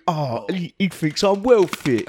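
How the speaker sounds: put-on girlfriend voice, drawn out at the end; flattered girlfriend. Girlfriend receiving the key ring, mock-flattered put-on voice. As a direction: start small, then play it up slowly. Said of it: "And you think".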